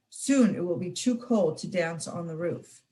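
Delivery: ordinary delivery, nothing marked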